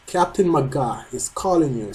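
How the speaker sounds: ordinary talk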